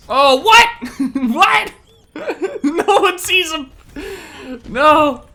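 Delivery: high, loud disbelief breaking into laughs; shocked laughing outcry. Real, not acted. Gamer stunned by an unseen enemy, laughing in high disbelief.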